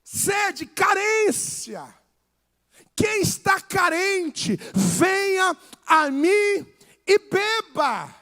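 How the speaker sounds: loud, driving sermon delivery; preaching at full force. Acted. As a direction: hammer the point home to the congregation. (pregação inflamada, em voz alta)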